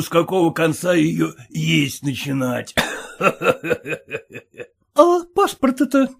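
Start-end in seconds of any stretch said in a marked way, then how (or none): 4.9-6.1 high pleading wife's voice; timid merchant's wife pleading, voiced high. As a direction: beg the husband to let her listen, pitched high. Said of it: "задала вопрос купчиха".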